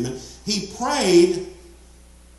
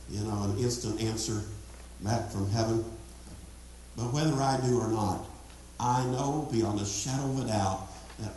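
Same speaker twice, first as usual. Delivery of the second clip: softer, lower, intimate preaching; quiet sermon passage; 5 semitones lower, 4 dB quieter than usual. Acted. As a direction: bring the congregation close, speaking softly. Preacher lowers into soft intimate stretch.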